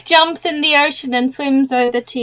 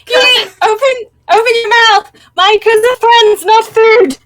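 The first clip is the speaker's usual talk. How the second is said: high, loud commanding shout; pleading shout at the moon goddess; urgent yelling; 10 semitones higher, 5 dB louder than usual. Acted. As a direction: order the beast to let go. Caspian yelling at Yuli.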